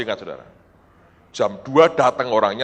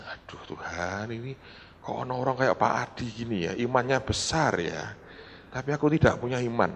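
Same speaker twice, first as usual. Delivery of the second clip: dejected lament; 3 semitones lower, 9 dB quieter than usual. Retold or imitated. Young preacher confides lacking faith to God, quietly dejected. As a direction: lower your voice into a weary private complaint.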